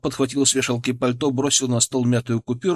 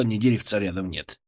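plain reading voice

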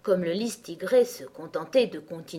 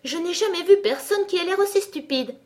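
very high, loud insult; abrupt outburst; 12 semitones higher, 7 dB louder than usual. A narrator's reading. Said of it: "dit une violette si brusquement". (remarque brusque, voix très aiguë)

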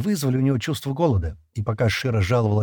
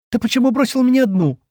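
much higher, louder hysterical cry; hysterical shout; 14 semitones higher, 5 dB louder than usual. Acted. Shira screams at Stan for leaving her alone, hysterical. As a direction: scream it at him, close to tears. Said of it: "истерично крикнула Шира".

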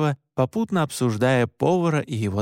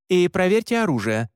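higher, commanding instruction; giving orders; 5 semitones higher than usual. A narrator's reading. Leader ordering hunters to check weapons, commanding. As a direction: issue it as a firm order. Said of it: "распоряжалась она".

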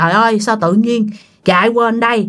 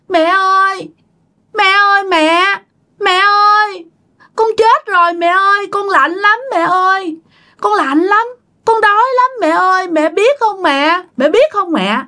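put-on high-pitched child spirit wailing to mother; possessed child voice; 8 semitones higher than usual. Dead child speaking through Bà Hai to mother, child voice. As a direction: plead like a little drowned child. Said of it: "như một đứa con nít".

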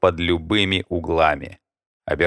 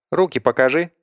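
sharp; 8 semitones higher than usual. A narrator's reading. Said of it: "вдруг резко спросила рыжая".

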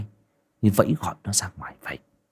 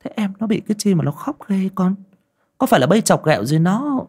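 worried mother; 10 semitones higher, faster than usual. A narrator's reading. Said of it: "Cô hoang mang quay sang nhìn".

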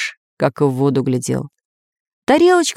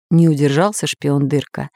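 plain reading voice

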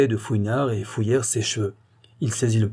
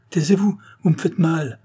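higher, louder cry of pain; anguished plea; 7 semitones higher, 4 dB louder than usual. Acted. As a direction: beg him to stop, hurting. Doctor pained by accusation. (supplication angoissée, plus aiguë et plus forte)

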